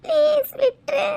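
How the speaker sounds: slow, high sobbing plea; crying plea. Acted. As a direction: sob out the plea to let go. Girl begs thug to let go. (அழுதுகொண்டே கெஞ்சல்)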